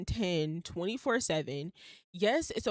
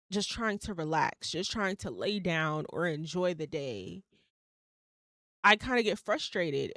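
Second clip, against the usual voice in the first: ordinary talk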